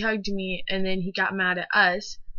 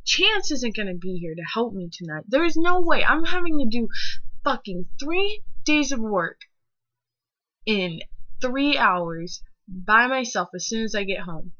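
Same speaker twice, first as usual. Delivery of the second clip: higher, louder heated rant at the teacher; student ranting at her teacher about doing the project alone, raised and loud; angry rant; 4 semitones higher, 5 dB louder than usual. Retold or imitated. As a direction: vent everything at him in one burst. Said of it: "And I was like".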